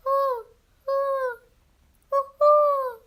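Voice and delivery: owl impression. Five-year-old hooting for the radio show, high owl calls. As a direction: hoot like a little owl. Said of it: "here's my owl impression".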